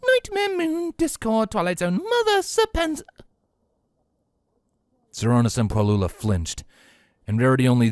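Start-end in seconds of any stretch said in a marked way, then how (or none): 0.0-3.0 high, light and airy; breezy lady voice. Acted. Rarity reassuring the sisters, breezy high voice. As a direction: brush off danger with ease.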